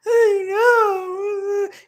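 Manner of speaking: high, loud crying wail; mock crying. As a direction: wail it through tears. Her crying reply to dad.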